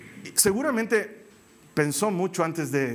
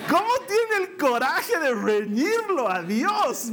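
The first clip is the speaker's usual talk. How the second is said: slow, high amazed question; slow incredulous disbelief; mock astonishment; 9 semitones higher, slower than usual. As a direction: act stunned at her nerve. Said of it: "Yo digo".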